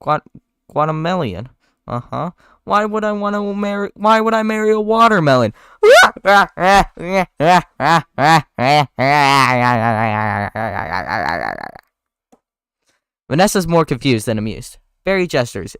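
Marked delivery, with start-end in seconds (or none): 2.6-5.5 higher, louder punchline voice; joke punchline. Acted. Barry telling his joke, hammed-up punchline. 5.8-11.8 long loud laugh at own joke; big laugh. Real, not acted. Barry laughing at his joke, long laugh.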